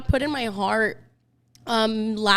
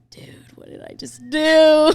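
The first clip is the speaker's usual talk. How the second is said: loud, raised cry of dismay; hammed-up sudden alarm; mock panicked regret; 5 semitones higher, 11 dB louder than usual. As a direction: wail it in comic disbelief at yourself. Re-enacts regret after impulsive buying.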